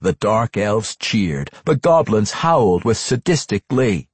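plain reading voice

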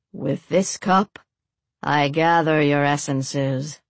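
slow, solemn incantation. A narrator's reading. Priest gathering blood in a cup, solemnly intoning. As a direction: intone it like a ritual. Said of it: "the priest said solemnly".

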